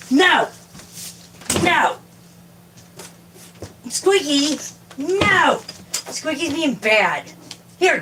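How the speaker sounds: high-pitched exasperated calls; scolding the cat. Real, not acted. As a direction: call out sharp commands to a naughty cat. Ordering the cat out.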